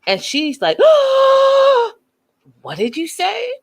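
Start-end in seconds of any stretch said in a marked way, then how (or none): 0.8-1.9 big, high mock gasp; shocked gasp. Wife reacting to being told to shut up, theatrical shocked gasp. 2.6-3.5 high-pitched mock disbelief; mock shock. Wife answering her husband's insult, mildly hammed-up shock.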